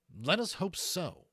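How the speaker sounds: loud, slow, cold retort; curt. A narrator's reading. Rankin doubting Levitas, cold and curt. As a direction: dismiss him coldly. Rankin dismissive to his dragon.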